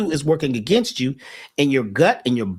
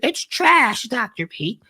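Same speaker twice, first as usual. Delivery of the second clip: much higher, punchy put-on voice; imitating the lady; 9 semitones higher, much slower than usual. Bank lady scolding his insurance policy, high emphatic voice. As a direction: hit trash up high and emphatic. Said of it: "She said".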